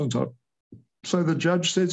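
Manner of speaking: ordinary talk, nothing marked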